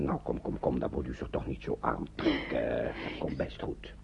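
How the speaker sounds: zacht en laag geruststellend; troostend. Acted. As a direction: sus haar zachtjes. Oom Rob troost de vrouw. (oom Rob troost de vrouw zacht en laag)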